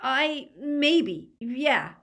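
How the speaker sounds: put-on high, hesitant boy; halting, unwilling confirmation; reluctant Draco. A narrator's reading. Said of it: "Draco's expression was full of reluctance".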